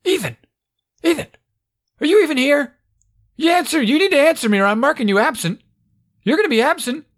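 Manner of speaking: high, insistent classroom bark; barking teacher impression. Teacher demanding answers from silent Ethan, barking. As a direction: imitate a teacher barking at an absent pupil. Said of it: "she's just barking at all the kids".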